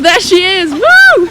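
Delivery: loud, high squeal of excitement; excited shout. Real, not acted. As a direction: shout it, rising into a whooping "woo!". Spots friend arriving, cheers.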